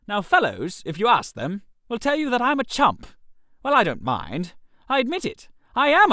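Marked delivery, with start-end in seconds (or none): none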